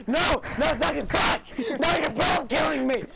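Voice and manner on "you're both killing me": ordinary delivery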